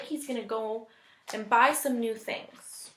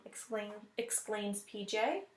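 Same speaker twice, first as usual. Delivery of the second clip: usual speaking voice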